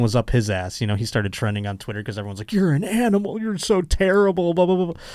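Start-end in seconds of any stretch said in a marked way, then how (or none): 2.5-5.0 high-pitched mocking whine; exaggerated put-on indignation, pitched way up; mock outraged mob. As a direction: mimic scolding critics in a high voice. Mimicking outraged Twitter pile-on.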